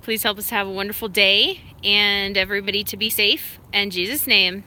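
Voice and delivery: a cheerful mock-solemn grace; half-playful prayer before the dog eats; mealtime prayer.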